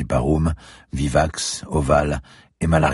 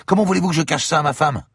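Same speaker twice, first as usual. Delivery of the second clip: high, loud desperate question; indignant protest; 14 semitones higher, 4 dB louder, faster than usual. Acted. Siberski protesting he cannot hide it from his wife. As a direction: plead against the order.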